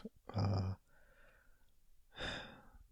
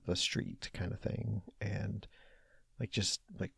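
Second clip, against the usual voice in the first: ordinary talk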